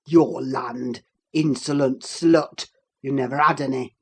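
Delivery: low, loud contempt; scornful Heathcliff. A narrator's reading. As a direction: sneer it down at her. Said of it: "said Heathcliff".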